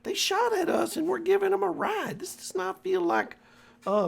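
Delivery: very high, worried NPC voice; high nervous voice. Acted. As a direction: pitch way up, fretting about the ride.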